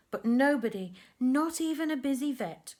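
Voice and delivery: usual speaking voice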